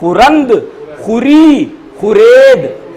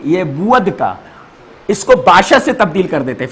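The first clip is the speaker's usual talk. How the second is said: ordinary talk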